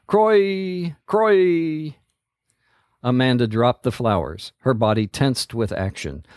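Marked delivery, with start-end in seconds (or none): 0.1-1.9 higher ringing call; ranger call. A narrator's reading. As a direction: call it out like a distant horn. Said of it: "answered by another and another".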